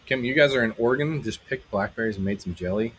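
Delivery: normal talking voice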